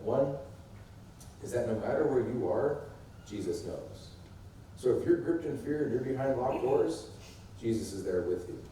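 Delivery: usual speaking voice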